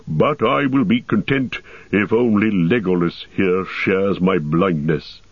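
dwarf voice with mild wry humour, slowing at the end; dwarf Gimli jesting. Acted. Dwarf mocking the blindfolded company, wry dwarf voice. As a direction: tease the elf as a grumbling dwarf.

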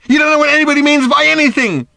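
high, loud, contemptuous shout; angry yell. Acted. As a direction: hurl it at him. Daughter yelling at her father.

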